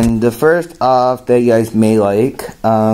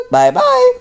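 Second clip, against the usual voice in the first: Woody Woodpecker voice. Acted. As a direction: squawk it like a cartoon woodpecker. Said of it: "Woody Woodpecker's gonna say something".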